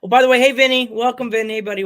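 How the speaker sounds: loud, high hey Vinny; excited greeting. Real, not acted. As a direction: call out a happy welcome. Greeting a viewer.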